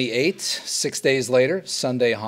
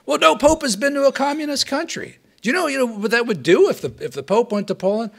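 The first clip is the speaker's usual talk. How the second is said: protesting communist official; 11 semitones higher, faster than usual. Acted. Acted quarrel, communists argue.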